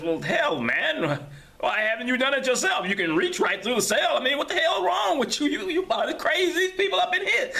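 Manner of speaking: high, loud, incredulous rant; exasperated. Acted. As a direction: throw up your hands at him. Ellington exasperated at prisoner.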